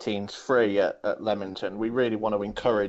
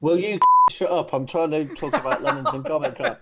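mock telling off; 7 semitones higher, 5 dB louder than usual.

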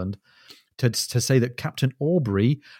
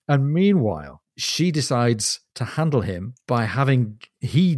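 ordinary talk, nothing marked

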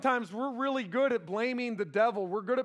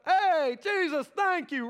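excited calling; 8 semitones higher, 7 dB louder, slower than usual. Pretend. Disciples calling back to Jesus.

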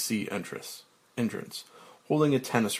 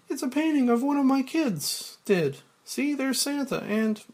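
lightly voiced eager secretary; chirpy assistant, pitched up; high secretary voice. Grace's voice in the script.

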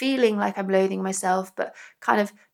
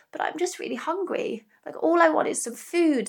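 put-on hungry little child; 7 semitones higher, 3 dB quieter than usual. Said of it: "you don't have the words ... to go".

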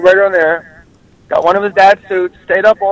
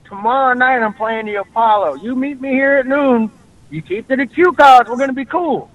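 imitating Nipsey Russell; 6 semitones higher than usual. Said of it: "He goes".

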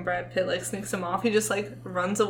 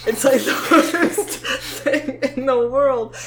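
loud laughing disgust, higher; 5 semitones higher, 9 dB louder than usual. Real, not acted. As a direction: squeal it out through laughter. Laughing about hating Q-tip feeling.

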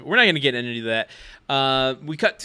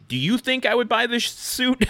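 deadpan; 9 semitones higher than usual. Retold or imitated. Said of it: "just kinda deadpan looks at her and goes".

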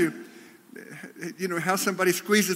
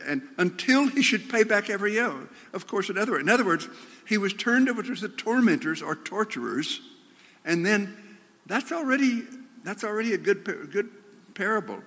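ordinary talk

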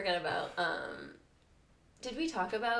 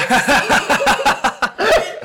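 loud, high cackling burst; helpless laughter, much louder than speech; big laugh; 7 semitones higher, 18 dB louder than usual. Real, not acted. Friend cracking up at the name mix-up, loud laughing.

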